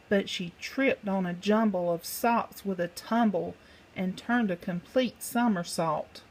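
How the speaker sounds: ordinary delivery, nothing marked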